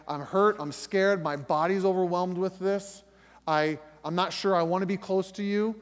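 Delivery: loud, pleading prayer to God; a believer's loud, raw, hurt prayer. Shown as a demonstration. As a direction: pour out hurt and anger to God, loudly.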